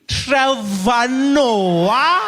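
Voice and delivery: very slow, high, loud "Trevor Noah?" rising; drawn-out recognition. Retold or imitated. Clerk realising the patient is the famous comedian, disbelieving. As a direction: stretch the name out in disbelief.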